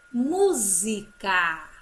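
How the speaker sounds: "Música" loud and slow with a buzzed Z. Shown as a demonstration. Teacher reading the word to pupils, Z in "Música". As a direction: sound out "Música", voicing the S as Z. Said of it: "o S tem o som de Z".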